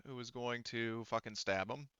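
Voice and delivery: ordinary delivery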